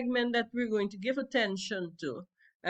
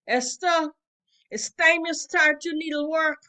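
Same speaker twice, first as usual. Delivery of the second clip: calling out; 6 semitones higher, 7 dB louder than usual. Acted. Mother ordering daughter inside.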